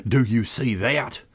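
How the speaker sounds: slow, strangled demand; tight, altered voice of outrage; choking with passion. A narrator's reading. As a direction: choke the words out in suppressed fury. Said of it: "in a voice choking with passion".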